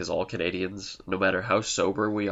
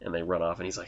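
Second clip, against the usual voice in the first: ordinary talk, nothing marked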